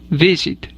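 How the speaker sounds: visit with the stress on VI; stress on first syllable. Shown as a demonstration. Teacher showing learners the accent in visit, stress on first syllable. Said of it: "tiene el acento en la primera sílaba".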